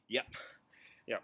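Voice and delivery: usual speaking voice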